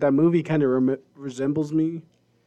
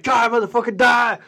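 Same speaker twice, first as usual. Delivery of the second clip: retold battle cry; 7 semitones higher than usual. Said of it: "and I was like".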